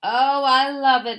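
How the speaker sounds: loud, slow, delighted exclaim; the fox exclaims loudly over his new gold tooth. A narrator's reading. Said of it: "exclaimed the fox".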